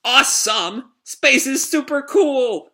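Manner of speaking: high, loud, drawn-out cheer; thrilled shout. Acted. Shiki cheering at the tentacle men, high and loud. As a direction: shout it out like a delighted kid.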